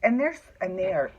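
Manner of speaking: ordinary delivery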